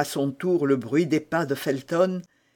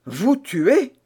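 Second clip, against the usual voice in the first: higher, louder gasp of terror; horrified; 7 semitones higher, 5 dB louder than usual. A narrator's reading. Felton repeats in terror to Milady. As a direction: recoil in horror. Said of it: "s'écria Felton avec terreur".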